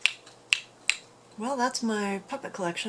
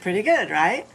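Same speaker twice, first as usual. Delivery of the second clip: much louder, pleased with herself; loud and proud; 11 dB louder than usual. Real, not acted. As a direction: show off the trick. Boasting after ventriloquism.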